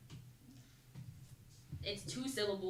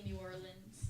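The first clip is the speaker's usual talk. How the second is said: New Orleans said with a New Orleans accent; 3 semitones lower, 7 dB quieter than usual. Shown as a demonstration. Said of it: "whenever I'm trying to do a New Orleans accent".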